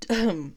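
throat clearing cough; choking throat clear. Real, not acted. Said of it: "I'm choking".

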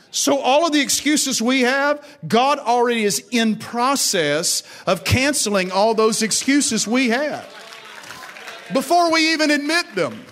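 high, loud, driving preacher's voice; full-force preaching. Acted. Preacher to congregation about their excuses, high and loud. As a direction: press the point hard on the congregation.

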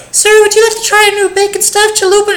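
very high, sing-song upsell voice; chirpy drive-thru worker. Retold or imitated. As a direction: chirp the sales pitch like a cashier.

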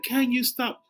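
high, lilting request; put-on dictation voice; dictating a shopping text, lightly mock.

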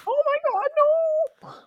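very high squealing cartoon voice; shrill mock panic. Dubbing a character's panic.

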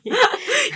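sudden high laugh that breaks out; burst of laughter. Real, not acted. Mother laughing at the Tiger King guess, high burst of laughter. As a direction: cracks up at the Tiger King joke.

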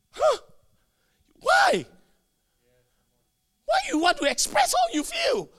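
high-pitched shouted question; exasperated, very high pitch; incredulous challenge. Real, not acted. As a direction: squeal the question in disbelief.

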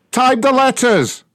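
slow, loud, furious order; angry shouting. Real, not acted. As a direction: bark it out slowly. Yelling order.

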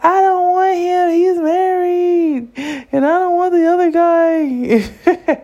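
very high, wailing mock cry ending in a laugh; hammed-up tearful whine about both men; retold sobbing. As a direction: cry it out half-laughing at herself. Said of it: "And I was, like, crying".

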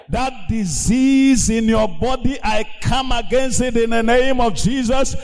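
pulpit-thumping healing decree; fiery preaching. Acted. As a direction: command the sickness out like a preacher. Healing command to congregation.

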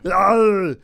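loud Arnold battle roar; Arnold grunt. Retold or imitated. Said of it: "my Arnold impersonation".

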